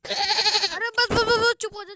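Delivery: loud, high cut-off yell; shouting to stop. Acted. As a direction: stop the song with a sharp shout. Teacher cuts off the singer.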